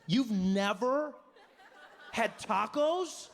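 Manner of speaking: incredulous. Retold or imitated. Dave incredulous again.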